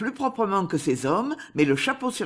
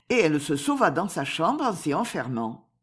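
usual speaking voice

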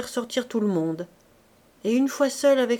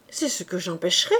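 fast, firm reply; quick retort; 3 semitones lower, faster than usual. A narrator's reading. Duchess quickly reassuring the weeping princess, fast firm reply. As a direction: fire the promise back quickly. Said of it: "répliqua vivement la duchesse".

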